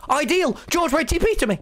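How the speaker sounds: high urgent shout; urgent call. Real, not acted.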